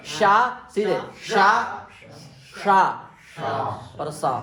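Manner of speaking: शा शा सा said one by one as a model; listen, then say शा, शा, सा; model sibilants. Shown as a demonstration. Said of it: "हमसे सुनो ना". (श ष स का आदर्श उच्चारण)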